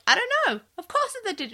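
high-pitched mock exasperation; aggressive sass. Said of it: "he's really aggressive about it".

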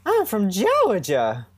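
high, slow Southern belle drawl from Georgia; Georgia accent. Shown as a demonstration. Host showing off to co-host, hammed-up Georgia accent. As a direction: channel Blanche Dubois with a drawn-out Georgia accent. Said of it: "I do a really good Georgia accent".

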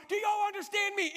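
high, exasperated mock yell; hammed-up drill-sergeant demand; freaked-out yelling. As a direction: sergeant bellowing to a whole bus. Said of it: "starts yelling at everybody".

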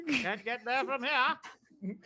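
drawling like a Maine farmer in a cookie ad; Maine accent. Retold or imitated. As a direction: put on the old Pepperidge Farm Maine voice. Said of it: "I'm speaking this way".

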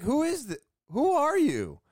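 high, loud, startled who-are-you; startled demand. Retold or imitated. Homeowner to a stranger in his driveway, a startled loud demand. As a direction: demand it, caught off guard and rattled.

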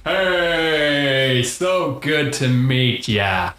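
friendly Texan drawl, words stretched; Texan accent. Acted. Santa greeting his rescuers, Texan accent. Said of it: "but really I'm from Texas".

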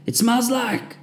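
high exhausted group shout. A narrator's reading. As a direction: yell it back from the mud. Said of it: "we managed to shout".

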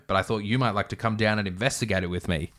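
ordinary delivery

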